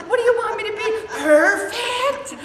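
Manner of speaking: high, loud, laughing protest; exasperated. Retold or imitated. Woman arguing with God, high exasperated laughing protest. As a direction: throw the question up at God, half laughing.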